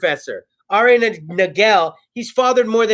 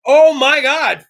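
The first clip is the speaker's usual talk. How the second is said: loud, high gloating cheer; hammed-up mock joy; mock excitement; 7 semitones higher, 3 dB louder than usual. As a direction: gloat over the lost ticket. Mock reenacting lottery loss reaction.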